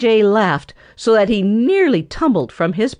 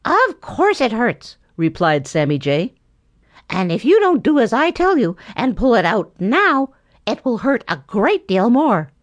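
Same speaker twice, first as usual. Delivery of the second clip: higher, bossy know-it-all bird voice; Sammy Jay lecturing; 6 semitones higher than usual. A narrator's reading. Sammy Jay lecturing Boxer about Prickly Porky, higher scolding voice. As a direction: scold the young cub like an elder.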